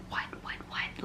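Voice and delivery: breathless alarm, whispered; frightened, hushed panic; freaking out. Retold or imitated. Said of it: "I was freaking out".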